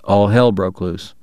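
ordinary delivery

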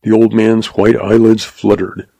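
plain reading voice

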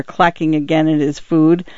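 ordinary delivery, nothing marked

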